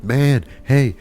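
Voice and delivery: trying to get a distracted man's attention; calling out. Customer calls to the HR guy wearing earbuds, loud high hailing.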